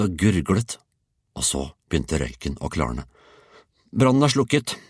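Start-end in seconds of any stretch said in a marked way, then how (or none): 3.9-4.8 put-on proud boy, higher pitch; proud announcement. A narrator's reading. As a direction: declare it proudly in a raised voice. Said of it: "erklærte Georg stolt".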